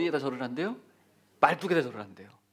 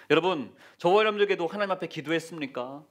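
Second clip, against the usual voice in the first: usual speaking voice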